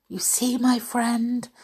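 usual speaking voice

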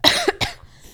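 a rough, airy hacking cough; sick-sounding hacking cough; harsh cough. Real, not acted. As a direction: cough hard and raspy. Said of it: "that sounded diseased".

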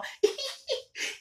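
squealing high mock woman's giggle; high falsetto giggle. Woman character laughing.

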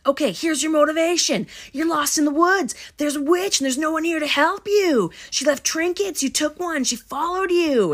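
high, loud acted taunting; aggressive imitation. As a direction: act out a hostile, upset tirade. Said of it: "like, really aggressive".

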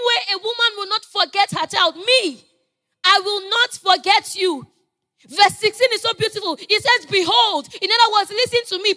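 high, loud, urgent sermon delivery; full-force preaching. Real, not acted. Preacher declaring God will not forget you, full-force preaching. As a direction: proclaim it to the whole congregation.

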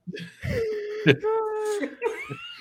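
a long high laugh that nearly turns to a cry; high helpless laugh. Real, not acted. Reacting to the cohost's impression, helpless high laugh. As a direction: fall apart laughing.

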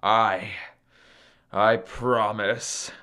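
low, slow, grudging promise; cross. Acted. Beaten Sun promises Maui, cross and reluctant. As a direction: give in crossly, haltingly. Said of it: "said Sun crossly".

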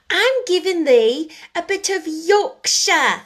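put-on girl proudly showing off her Yorkshire; triumphant Yorkshire. A narrator's reading. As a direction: crow it in broad Yorkshire. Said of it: "answered Mary triumphantly".

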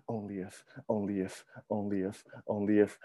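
soft, repeated lost "only if"; brooding murmur. Retold or imitated. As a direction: chant it quietly, stuck in regret. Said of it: "I was like".

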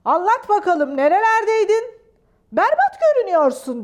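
high, loud scolding questions; booming demand for answers; thundering. Acted. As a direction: scold like a cross royal uncle. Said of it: "Gürledi".

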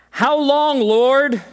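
high, loud lament cry; anguished cry. Acted. Preacher voicing David crying to God, high anguished call.

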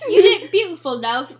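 loud mock British accent; hammed-up British compliment. Showing the co-host the tweet, loud British accent. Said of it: "our renditions of British people".

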